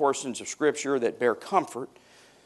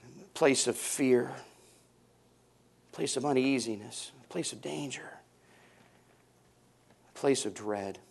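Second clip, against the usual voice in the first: fading dramatic hush; 7 dB quieter than usual. Acted. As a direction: let each line sink quieter into dread.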